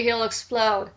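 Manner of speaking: ordinary talk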